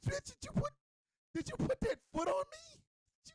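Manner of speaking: very high, squeaky disbelief; incredulous outrage. Acted. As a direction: shoot the question way up, scandalized. Acts out disbelief at the foot.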